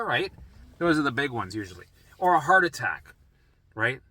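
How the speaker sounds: ordinary talk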